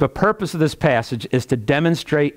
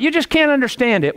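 high-pitched mock objection; imitated Bible doubter; 10 semitones higher than usual. Preacher mimicking someone who can't understand the Bible, high-pitched protest. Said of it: "they just give you all these different things".

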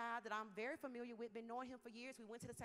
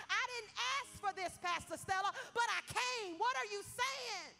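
pleading complaint to God; 7 semitones higher, 7 dB louder than usual. Retold or imitated. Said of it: "'Cause I'm like".

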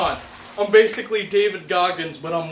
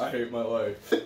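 mumbled; 9 semitones lower, 10 dB quieter than usual. Real, not acted. After ice bath.

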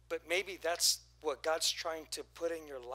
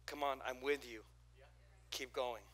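gentle encouragement; 4 semitones lower, 7 dB quieter than usual. Retold or imitated.

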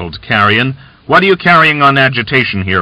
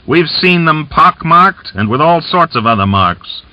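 louder, higher jeering shout; merry shout; 7 semitones higher, 4 dB louder than usual. A narrator's reading. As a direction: shout it out with rough good humour. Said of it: "laughing, he shouted".

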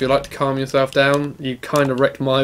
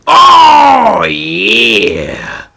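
loud, high drawn-out whoop; explosive cheer sliding down; triumphant shout; 4 semitones higher, 9 dB louder than usual. Real, not acted. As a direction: belt out a victory cry, falling at the end. Whooping during attack in game.

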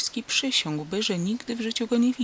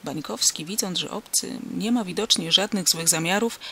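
ordinary delivery

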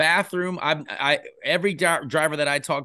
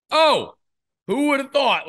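mock surprise; 10 semitones higher, 4 dB louder than usual. Retold reaction at Halloween party.

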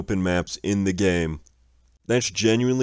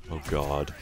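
normal talking voice

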